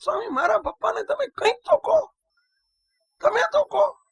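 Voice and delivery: put-on high, pleading child's wail; imitating a sobbing child. A monk imitates the crying boy begging him to speak to his father. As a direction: beg through tears like a desperate boy. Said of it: "રડતો જાય ને બોલતો જાય".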